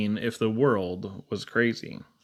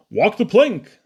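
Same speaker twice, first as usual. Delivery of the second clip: pirate captain order; 6 semitones higher, 5 dB louder, slower than usual. Acted. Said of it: "says Pirate Jim".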